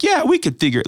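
high, airy, careless shrug of a voice; mocking a couple who drift into marriage, breezy and careless; mock carefree. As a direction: toss it off like nothing matters.

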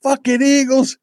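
high-pitched, exasperated swearing; worked-up fan outburst; retold angry cursing. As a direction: curse the losing team in frustration. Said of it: "I said".

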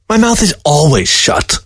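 high, indignant fox voice; fox protesting. Acted. Fox protests to the old man, high and indignant. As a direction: protest your innocence, a little hurt. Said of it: "Fox protested".

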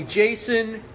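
normal talking voice

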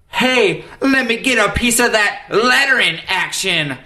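high mock voice; lightly voiced goofy request; imitating Tim. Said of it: "That's exactly what I sounded like".

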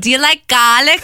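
thick Boston bartender voice; heavy Boston accent, asking. Retold or imitated. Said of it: "world-class impression of the bartender".

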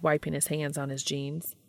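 ordinary delivery, nothing marked

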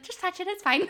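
high, loud playful insistence; mock urging. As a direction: egg someone on, high and pushy.

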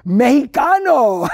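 put-on loud, drawn-out Mexican accent; imitated Mexican Spanish accent, stretched out. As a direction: proudly belt it out in a Mexican accent. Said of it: "he has an accent".

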